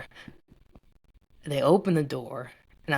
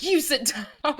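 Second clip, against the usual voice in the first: high, laughing mock order; laughing command; 12 semitones higher than usual. Telling someone off while breaking into laughter. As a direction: bark it out then crack up laughing.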